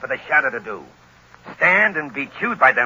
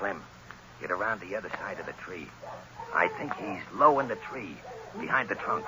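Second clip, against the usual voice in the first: quiet instruction; 7 semitones lower, 13 dB quieter than usual. Acted. Gunman quietly directs his partner.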